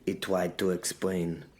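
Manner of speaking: ordinary delivery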